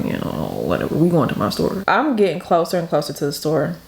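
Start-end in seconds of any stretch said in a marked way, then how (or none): 0.0-1.8 dismissive mumble. Retold or imitated. Kid ignoring mom, dismissive.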